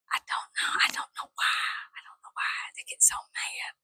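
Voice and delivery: whispered, baffled. Real, not acted. Vlogger to viewers about angry men, whispered. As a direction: breathe it out in a whisper.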